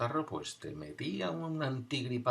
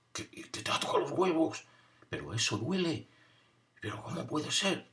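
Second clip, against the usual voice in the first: voz aguda y asombrada de niño; incredulidad de niño; 7 semitones higher than usual. Retold or imitated. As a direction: imita el asombro de un crío. Otros niños reaccionan incrédulos.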